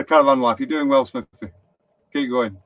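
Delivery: ordinary talk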